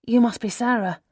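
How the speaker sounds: put-on Australian accent. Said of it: "she says in an unmistakable Australian accent".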